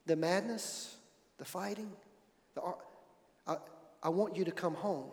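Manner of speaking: soft, faltering plea with long pauses; quietly imitating a wife's tearful plea; broken voice. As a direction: let the voice nearly give out as you beg him home. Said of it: "in a broken voice".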